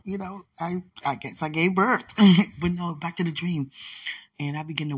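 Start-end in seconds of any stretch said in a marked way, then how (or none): 2.1-2.5 sharp loud burst of laughter. Real, not acted. Laughing at giving birth in the spiritual realm.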